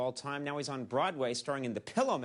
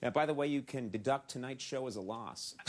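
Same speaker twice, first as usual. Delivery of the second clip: ordinary talk, nothing marked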